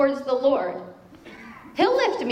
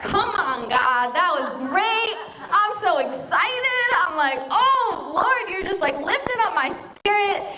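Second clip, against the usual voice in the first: higher, slower joyful praise; excited; 7 semitones higher than usual. Retold or imitated. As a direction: gush with delight at God.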